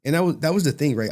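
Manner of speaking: usual speaking voice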